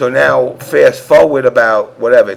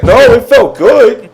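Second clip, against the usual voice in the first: high, loud mock female voice; hammed-up girl's reply, pitched way up; imitated woman; 10 semitones higher, 7 dB louder, slower than usual. Storyteller quoting the woman's reply, imitated and high.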